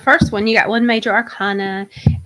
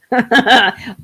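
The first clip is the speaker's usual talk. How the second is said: loud laugh; 7 dB louder than usual. Real, not acted. Joking she feels like a hooker.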